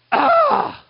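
high loud mock cry of panic; overwhelmed yelp. Preacher showing his panic at the building work, loud yelp. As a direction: squeal like it's all too much. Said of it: "I'm just going".

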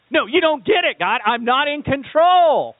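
a frustrated leader crying out to God; exasperated Moses. Retold or imitated. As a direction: Moses pleading loudly with God over whiny Israelites. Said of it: "at his wit's end".

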